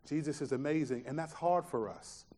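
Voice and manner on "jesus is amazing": soft, hushed awe; quiet, awed delivery of the wow. Retold or imitated. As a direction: breathe it out in wonder. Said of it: "what we should walk out of here is like".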